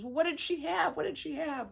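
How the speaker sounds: high, eager questioning voice; landlady calling after the father, high and eager. Retold or imitated.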